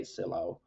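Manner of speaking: Sylow said as "SEE-low"; US pronunciation. Shown as a demonstration. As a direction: end Sylow on an open "oh", no final consonant. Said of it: "but we typically".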